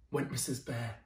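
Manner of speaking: ordinary delivery, nothing marked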